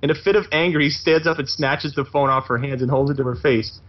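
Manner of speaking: usual speaking voice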